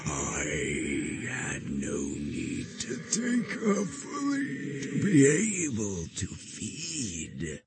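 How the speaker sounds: high, slow, otherworldly creature sneer; mocking monster voice. A narrator's reading. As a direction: gloat slowly like a mirror demon. The creature gloats at the Guardian.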